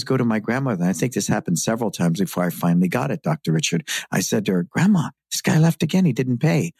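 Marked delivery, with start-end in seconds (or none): 4.6-6.7 put-on high, excited little kid; as a young boy tattling; child voice. Child self quoted to grandmother.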